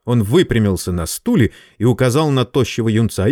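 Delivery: ordinary delivery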